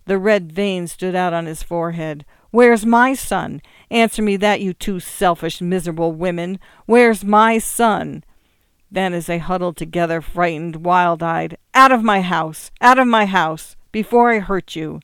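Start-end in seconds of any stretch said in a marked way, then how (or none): 2.5-8.2 anguished fury. A narrator's reading. Joe demanding his son from his sisters, anguished fury. 11.7-15.0 much louder raging shout; furious shout. A narrator's reading. Joe ordering his terrified sisters out, furious shout.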